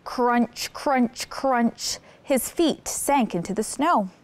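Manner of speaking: ordinary talk, nothing marked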